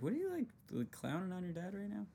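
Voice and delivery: retelling his shock at a friend's fast Spanish, high disbelief; incredulous. Retold or imitated. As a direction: act stunned that he'd mock his dad. Retold question to his friend.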